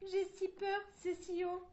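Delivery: put-on high, loud scared little girl; frightened stammer. A narrator's reading. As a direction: protest nervously from up high. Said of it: "bégaya Maria".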